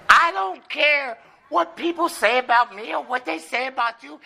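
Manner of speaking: high-pitched wife voice talking back; hammed-up defiant wife. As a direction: argue back high and unbothered. Acted wife answering back in joke.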